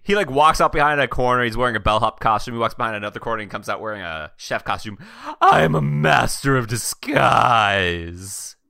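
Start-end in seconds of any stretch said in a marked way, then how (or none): 5.4-8.6 slow, drawn-out grand proclamation; boastful disguise artist. Acted. Player voices a costume-changing character's boast, grandly drawn out. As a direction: stretch it out like a theatrical reveal.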